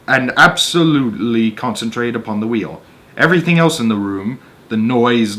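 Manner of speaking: measured read-aloud narrator voice; story narration. A narrator's reading. Reading a Roald Dahl story to an audience, storyteller voice. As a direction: tell the tale steadily to listeners.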